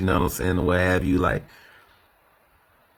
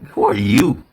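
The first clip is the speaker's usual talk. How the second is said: louder sarcastic who are you; 6 dB louder than usual. Shown as a demonstration. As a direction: sneer the question. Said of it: "now it's the sarcasm".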